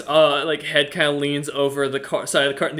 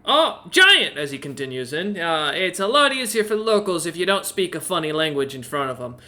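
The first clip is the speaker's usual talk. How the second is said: high, eager little owl voice; chirpy owl innkeeper; 3 semitones higher than usual. Acted. Owl innkeeper welcoming Roloman, high and eager. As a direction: play the helpful owl host, pitched up.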